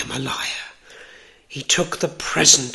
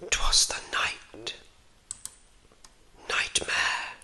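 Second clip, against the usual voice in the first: hushed, spooky bedtime-story whisper; breathy whispered narration for a spooky tale; whispered storytelling; 4 semitones lower, 3 dB louder than usual. A narrator's reading. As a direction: whisper the tale with long, suspenseful pauses.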